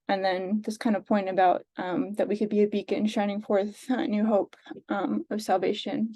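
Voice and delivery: ordinary talk, nothing marked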